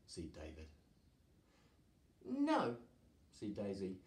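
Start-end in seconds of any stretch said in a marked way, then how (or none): 2.2-2.8 high-pitched objection; high storybook dragon. A narrator's reading. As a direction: object in a lifted character voice.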